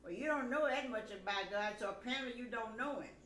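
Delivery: retold anger. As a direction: let the old anger back in. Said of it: "I lost my cool again".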